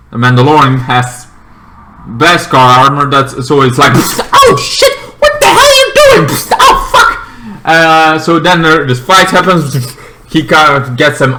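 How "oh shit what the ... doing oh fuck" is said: very high, hurried mock panic; hammed-up high-pitched freak-out; panicked cursing. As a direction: squeal it out in alarm. Acting out panic in the fight.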